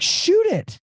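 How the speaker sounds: a sudden high urgent "Shoot it"; urgent shout. Retold or imitated. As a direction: jump way up in pitch, pushing him to take the shot. Urging buddy by text on buck.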